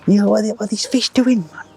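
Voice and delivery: high, hammed-up Welsh accent; mock Welsh angler griping to the fishery manager; [name]'s Welsh accent. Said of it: "not got a very good Welsh accent".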